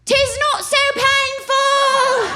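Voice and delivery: put-on high, slow olde British accent. Comic's witch, first take, in a British accent. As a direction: play it as a calm English Puritan. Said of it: "that was a British accent".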